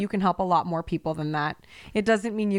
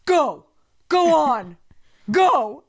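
loud, raised shout imitating a man; imitated man's yell; 7 semitones higher, 7 dB louder, much slower than usual. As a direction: bellow it like a man scaring off an animal. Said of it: "I hear this deep male voice go".

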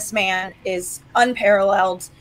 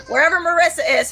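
ordinary talk

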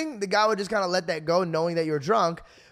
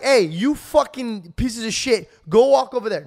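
mock rude bark; 3 semitones higher, 5 dB louder than usual. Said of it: "He wasn't like".

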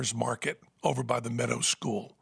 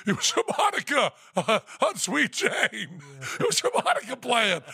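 laughing Lou; 8 semitones higher than usual. Retold or imitated. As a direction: say it cracking up.

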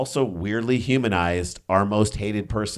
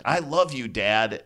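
high-pitched imitation of a texted shout; lightly mimicked yell, pitched up; mock all-caps yelling; 5 semitones higher, slower than usual. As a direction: act out an all-caps message without really shouting. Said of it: "all caps, and I was like, "Why are you yelling at me?".

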